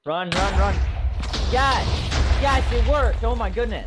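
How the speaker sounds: high, loud triumphant shouts; excited yelling. Real, not acted. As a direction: scream with joy as it works. Cannon trap fires and works.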